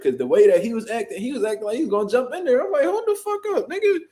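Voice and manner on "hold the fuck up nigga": very high, urgent cry; high-pitched panicked warning; retold alarm. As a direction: stop him before he jumps in. Alarmed as the man charges.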